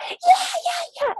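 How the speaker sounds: high but hushed mock cheering; silent mock scream. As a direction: scream without sound. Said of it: "we pretend like we're screaming".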